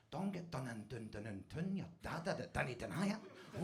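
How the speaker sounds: very soft, low rhythmic chant; hushed, private groove on the words; getting into it. Retold or imitated. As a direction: murmur it under the breath like a beat. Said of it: "I start getting into it".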